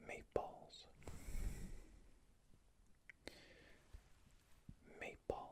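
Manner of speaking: faint whisper from further away; barely-there whisper at a distance; distant whisper. Shown as a demonstration. Examiner whispering from further away for a hearing test, faint whisper. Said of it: "I'm gonna whisper in this other one".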